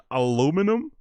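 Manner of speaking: puzzled aluminum, "uh-LOO-mi-num", rising at the end; mock American pronunciation. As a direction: query aluminum with a stretched "LOO". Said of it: "You guys pronounce it aluminum".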